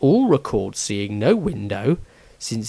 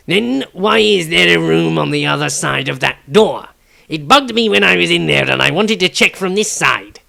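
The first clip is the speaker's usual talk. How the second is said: loud, higher, quick thief voice; halfling thief voice; 5 semitones higher, 4 dB louder than usual. Acted. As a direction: press the group eagerly. Tobias voiced in story.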